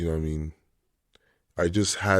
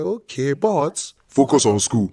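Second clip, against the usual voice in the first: high, loud stern parent; 6 semitones higher, 6 dB louder than usual. Retold or imitated. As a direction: lay down the law as a parent. Parents voiced in story.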